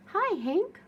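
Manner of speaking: bright high call across the desert; cheerful call. Acted. Tumbleweed calling a greeting to the cactus. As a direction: call out hello with a lift. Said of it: "Rosie the Tumbleweed called out".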